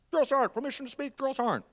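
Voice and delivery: high, formal recruit call; high-pitched mock military request; acted recruit. Acted recruit asking permission to speak.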